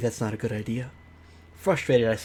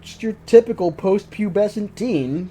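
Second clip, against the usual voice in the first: sarcastic; 7 semitones higher, 8 dB louder than usual. Real, not acted. Sarcastic jab at teens.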